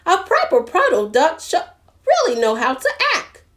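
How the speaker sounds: high-pitched scolding hen; squawking hen. A narrator's reading. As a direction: squawk it like a fussy hen laying down rules. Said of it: "Henny squawked".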